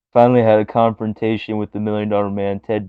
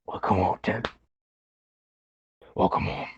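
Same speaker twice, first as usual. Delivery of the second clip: whisper; 6 dB quieter than usual. Retold or imitated. Echoing quote in whisper.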